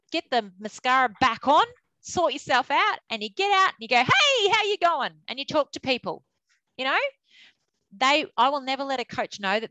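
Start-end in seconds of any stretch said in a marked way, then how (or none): 4.0-5.1 high, slow bright hello; breezy greeting. Retold or imitated. Manager greeting people after crying, breezy put-on cheer. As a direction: hide the tears behind cheer. Putting on brave cheerful face.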